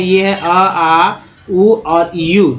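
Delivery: each vowel drawn out slowly; teacher spelling out the sounds slowly; vowel sounds demo. Shown as a demonstration. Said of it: "यू को दोस्तों चार तरह से पढ़ते हैं".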